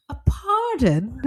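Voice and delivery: slow, high-lifted polite query; polite interjection. Acted. Parent modelling how a child should interrupt, polite and high. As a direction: draw it out high, politely asking to cut in. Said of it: "get him to be like".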